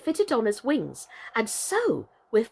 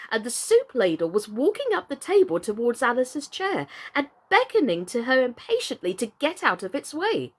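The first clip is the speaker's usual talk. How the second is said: usual speaking voice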